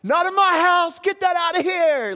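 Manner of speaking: put-on high, loud child's voice; mimicking daughter's trash talk; little girl trash talk. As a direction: crow like a proud little kid. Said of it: "talking trash".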